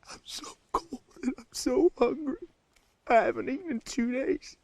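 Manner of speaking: crying. Acted. Starving and freezing, breaking down.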